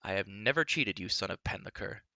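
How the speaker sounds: usual speaking voice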